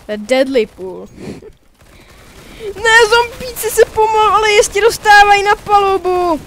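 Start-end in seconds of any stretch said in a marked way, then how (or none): 2.8-6.4 1 mock alarm. Real, not acted. Mock dramatic report.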